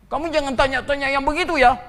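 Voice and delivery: high, loud and fast mock outrage; huffy churchgoer telling off the questioner; offended church member rebuffing a question, loud and quick; indignant retort. Offended churchgoer snaps back.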